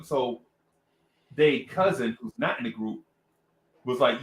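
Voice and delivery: ordinary talk, nothing marked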